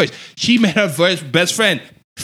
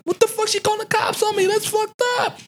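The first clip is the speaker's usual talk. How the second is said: very high, fast, strained disbelief; incredulous outrage; 4 dB quieter than usual. Retold or imitated. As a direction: squeal it out in shocked outrage. Retold outrage at cops called.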